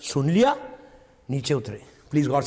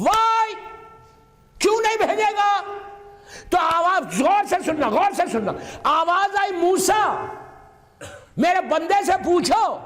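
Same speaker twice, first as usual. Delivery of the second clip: full-voiced majlis preaching; fervent preaching; 11 semitones higher than usual. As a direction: rouse the gathering with every line.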